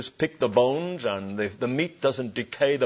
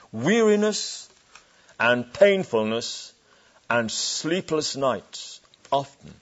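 solemn paraphrase; slower than usual. Acted. Preacher restating the verse for the congregation, slow and heavy. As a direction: lean into each word of suffering.

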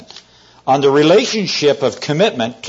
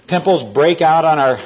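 ordinary talk

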